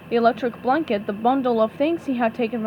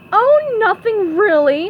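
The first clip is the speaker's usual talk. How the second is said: slow, higher, loud mock innocence; sarcastic; 9 semitones higher, 8 dB louder, slower than usual. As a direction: drip with sarcasm. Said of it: "answered sarcastically".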